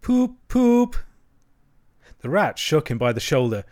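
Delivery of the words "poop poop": high hooting "poop, poop"; imitated car horn. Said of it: "still heard to murmur".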